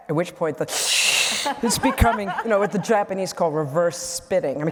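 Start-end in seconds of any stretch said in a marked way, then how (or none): 1.6-4.6 words broken by real laughter, a bit louder; chuckling as she tells the punchline; laughing through words. Real, not acted. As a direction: try to talk while laughing hard. Audience laughter, speaker laughing.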